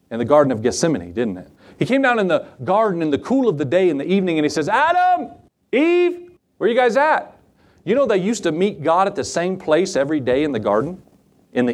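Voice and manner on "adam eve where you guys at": high, drawn-out call for missing people. Acted. God looking for Adam and Eve in the garden, calling out. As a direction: call their names in a high singing-out voice.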